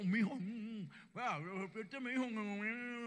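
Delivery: acted mumbling through a mouthful; mock talking with fruit crammed in; mouth stuffed. Eve to her husband while eating fruit, mouth-full mumble. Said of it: "comiendo y toda embutida".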